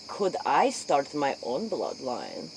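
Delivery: usual speaking voice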